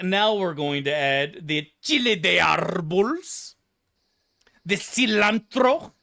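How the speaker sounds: slow, hammed-up Spanish accent with rolled r's; ridiculous Spanish accent. Mocking cooks who overemphasize foreign words, exaggerated Spanish accent. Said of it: "attaching the most ridiculous accent to it ever".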